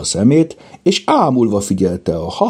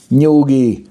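high, louder call to settle down; hushing rebuke; 8 semitones higher, 5 dB louder than usual. A narrator's reading. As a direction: shush them firmly, pitch raised. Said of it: "intette le Mimi".

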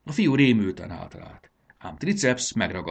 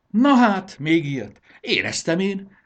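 high, loud delighted exclamation; old man suddenly overjoyed; cheering up; 11 semitones higher, 9 dB louder than usual. A narrator's reading. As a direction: burst out in happy surprise. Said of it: "vidult fel az öreg".

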